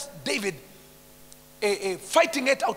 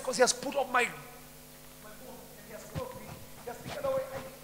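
ordinary talk

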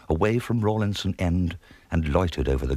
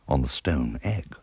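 ordinary delivery

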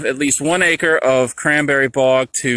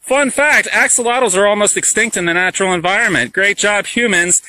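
sarcastic; 6 semitones higher than usual. Real, not acted. Sarcastic fun fact.

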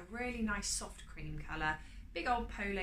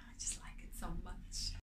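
soft, breathy whispered aside; 6 dB quieter than usual. Real, not acted. Confiding love for the jumper, whispering. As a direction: whisper it fondly to yourself.

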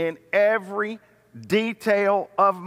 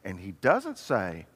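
ordinary talk

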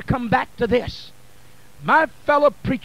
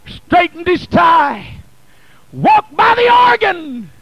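loud, driving sermon delivery, lines thrown out; preaching at full force; 3 semitones higher, 5 dB louder than usual. Acted. Preacher to congregation, building the story with full-force preaching. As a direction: preach it to the rafters.

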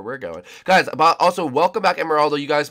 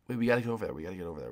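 ordinary talk

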